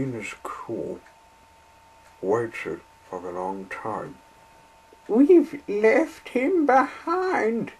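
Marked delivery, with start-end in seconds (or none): 5.1-7.7 much higher, slow mock wail; sobbing coaches. Coaches lamenting their guard, slow high sobbing. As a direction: weep slowly, high and drawn out. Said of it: "sobbed the coaches".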